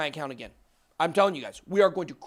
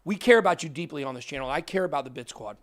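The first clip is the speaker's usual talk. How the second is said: ordinary talk, nothing marked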